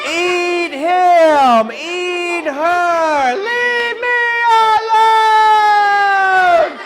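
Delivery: high, loud terrified yelling; hammed-up panicked child screaming, drawn out at the end; panicked shout. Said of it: "I was like".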